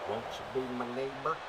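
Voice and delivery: soft, gentle kids' TV host, lightly voiced; Mister Rogers impression. Streamer shows chat the voice is similar, soft Rogers imitation. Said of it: "Mister Rogers".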